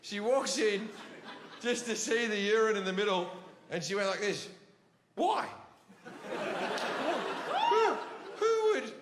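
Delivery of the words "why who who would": high, halting mock disbelief; stammered, high-pitched bewilderment; speechless parent. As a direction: imitate a mother lost for words. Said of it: "when they have no words".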